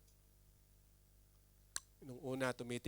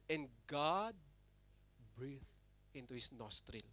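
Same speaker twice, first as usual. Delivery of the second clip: hushed reading; 4 semitones lower, 11 dB quieter, slower than usual. Acted. Reading Genesis quote softly.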